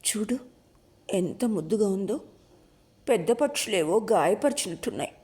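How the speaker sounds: a mild catch in the throat; gently grieving over the hurt bird; choked voice. A narrator's reading. Sabari showing Jyothi the wounded bird, mildly choked. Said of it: "గద్గద కంఠంతో అన్నది శబరి".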